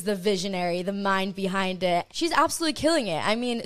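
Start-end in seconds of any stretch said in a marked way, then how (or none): 2.1-3.3 excited praise. Real, not acted. Praising guest enthusiastically.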